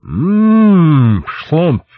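long, high mmm of relish; savouring. Acted. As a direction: savour a juicy mouthful, loud and drawn-out. Savouring a huge bite of pear.

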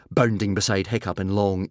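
plain reading voice

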